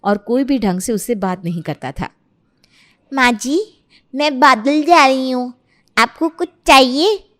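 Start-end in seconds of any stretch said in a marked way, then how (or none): 3.1-7.2 put-on loud, childlike lisp; lisping daughter-in-law. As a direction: ask mother-in-law with muddled baby consonants. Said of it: "Nimita के तोतलेपन के कारण".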